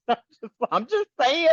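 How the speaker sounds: ordinary delivery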